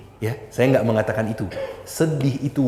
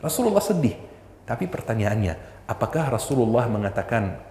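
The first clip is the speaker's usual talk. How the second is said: usual speaking voice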